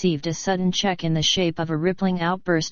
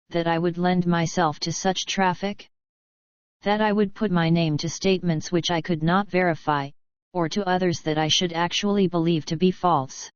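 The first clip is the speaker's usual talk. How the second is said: usual speaking voice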